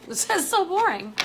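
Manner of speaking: high, loud laughing complaint. Real, not acted. Laughing at boring American accent.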